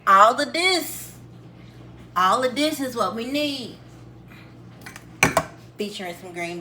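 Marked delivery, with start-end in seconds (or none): 0.1-3.8 excited announcement. Real, not acted. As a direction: present it big and proud. Showing off the ingredients.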